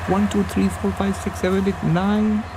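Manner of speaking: a touch of delighted counting; excited recount. Retold or imitated. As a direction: count with rising wonder. Recounting, now including himself.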